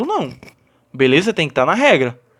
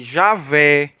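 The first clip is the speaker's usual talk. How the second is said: slow, lower "javé", weight on final VÉ; last-syllable stress; 4 semitones lower than usual. Shown as a demonstration. Teacher giving students an oxytone example, stress on final VÉ of "javé". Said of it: "com um acento na última sílaba".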